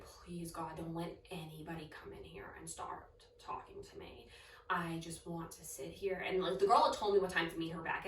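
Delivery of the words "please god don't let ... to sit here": barely voiced plea not to be found; hushed desperate prayer. Scared new student whispering a prayer alone in cubicle. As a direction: whisper it under your breath, begging.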